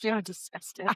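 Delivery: muttered under breath; mumbled punchline. Real, not acted. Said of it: "What did you just mumble there".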